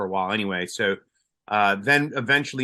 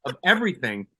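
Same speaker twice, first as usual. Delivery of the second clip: ordinary talk, nothing marked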